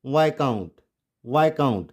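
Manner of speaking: viscount as VAI-count; silent S. Shown as a demonstration. Teacher gives correct reading twice, silent S. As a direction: say viscount with no s, falling. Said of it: "इसको क्या बोलेंगे".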